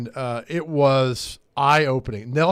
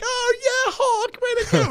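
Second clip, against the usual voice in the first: squealing high falsetto cheer, half laughing; giddy excitement. Retold or imitated. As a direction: shriek it like a starstruck fan. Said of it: "We were just so excited".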